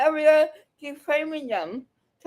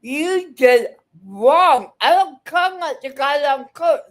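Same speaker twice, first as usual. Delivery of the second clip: angry accusation; 6 dB louder than usual. Real, not acted. Heated confrontation, accusing him.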